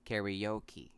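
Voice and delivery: "karaoke" as kair-ee-OH-kee; American pronunciation. Shown as a demonstration. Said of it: "this is pronounced".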